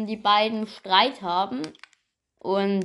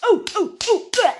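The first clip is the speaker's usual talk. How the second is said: mock pain groans; 8 semitones higher, 5 dB louder, faster than usual. Character hit, pain cries.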